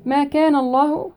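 tajweed recitation; Quran recitation. Shown as a demonstration. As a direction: recite it with tajweed, stretching the vowels.

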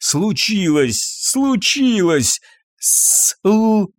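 drawn-out, rising stammer. A narrator's reading. Panicked husband cannot tell wife what happened, stammering.

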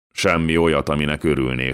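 usual speaking voice

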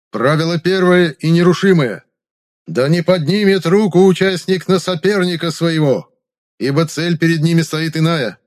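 high, loud herald proclamation; town crier. A narrator's reading. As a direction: proclaim the king's will to the square. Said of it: "глашатай, обязанный объявлять публике".

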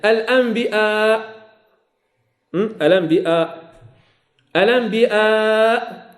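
الأنبياء slow, stretching the long vowels; drawn-out madd. Shown as a demonstration. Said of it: "كذلك". (مد طويل في الأنبياء)

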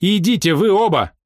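high, loud dismissal; angry order. A narrator's reading. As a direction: order them both out. Фрэнсис выгоняет обоих. (гневный приказ)

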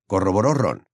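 usual speaking voice

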